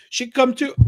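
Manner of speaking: coaxing come-on in a put-on high voice; high mock sales pitch from a woman touting on the street; soliciting woman. Solicitor includes his mom, retold.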